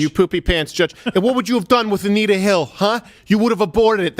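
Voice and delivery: high-pitched parody of an angry ranter; mocking imitation. Mocking the rant just played.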